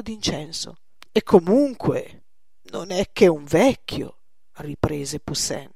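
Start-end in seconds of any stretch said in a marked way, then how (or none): 1.1-4.2 loud, slow dismissal; insistent persuading. Acted. Poussin persuades Gillette about the old man, insistent. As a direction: press the point, drawn out and loud. Painter presses his lover to agree.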